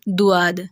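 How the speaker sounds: دواد with a plain d sound; dal mistake. Shown as a demonstration. Teacher showing the dal error. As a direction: say it wrongly as دواد. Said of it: "دال سے پڑھ رہے ہوتے ہیں دواد".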